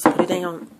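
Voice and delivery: ordinary talk, nothing marked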